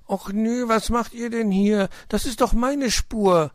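put-on very high, loud childish objection; indignant. A narrator's reading. Young detective to friends on his trail, indignant. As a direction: stake your claim like an affronted child.

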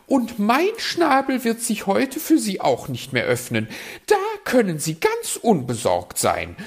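high, loud, huffy bird voice; high huffy magpie; offended magpie. Acted. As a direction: snub the fox and fly off.

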